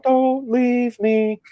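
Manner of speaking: high, slow, pleading whine. Retold or imitated. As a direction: beg him not to go, high and drawn out. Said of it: "And I'm like".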